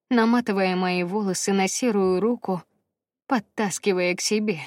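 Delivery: ordinary delivery, nothing marked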